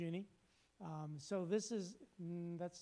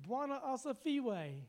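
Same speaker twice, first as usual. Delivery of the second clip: exuberant greeting; 9 semitones higher, 4 dB louder than usual. Retold or imitated. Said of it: "they would always say".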